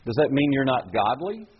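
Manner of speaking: ordinary talk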